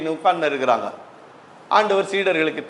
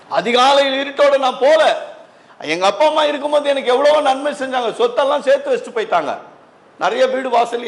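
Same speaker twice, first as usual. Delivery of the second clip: high-pitched, emphatic sermon delivery; fervent preaching; 8 semitones higher than usual. Acted. Preacher telling church of her changed life, fervent. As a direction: preach it high, stretching key phrases. Preaching on own parents' grave.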